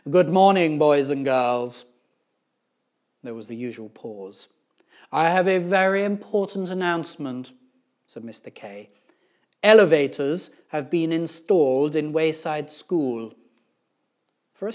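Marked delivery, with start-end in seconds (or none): 0.1-1.9 booming, very loud principal's announcement. A narrator's reading. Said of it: "Mister Kidswatter's voice came over the loudspeaker". 5.1-7.5 loud, high principal's announcement; booming loudspeaker-style proclamation. A narrator's reading. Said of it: "Mister Kidswatter's voice came over the loudspeaker". 9.6-13.3 booming principal's announcement; very loud loudspeaker-style delivery. A narrator's reading. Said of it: "Mister Kidswatter's voice came over the loudspeaker".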